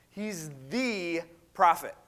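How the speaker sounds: "the" set apart and drawn out; slow down and lean on "the"; stress on THE. Retold or imitated. Preacher singling out the one awaited prophet, stress on "the". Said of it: "He's not just a prophet".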